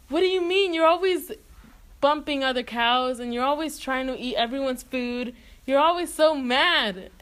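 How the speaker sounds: high-pitched, exasperated retort; mock indignation. Rasputin arguing back at the angry cow, mock indignation. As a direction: protest in playful, acted outrage.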